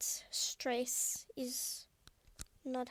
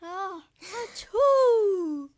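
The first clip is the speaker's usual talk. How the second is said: big loud sneeze; sneezing; 8 semitones higher, 11 dB louder, much slower than usual. Real, not acted. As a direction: let out a full sneeze, drawn out. Loud sneeze mid-podcast.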